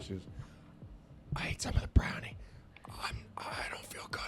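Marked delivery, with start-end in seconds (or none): 1.4-4.2 whispered, as a sick mom on the phone; retold whisper. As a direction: hush it like you feel unwell.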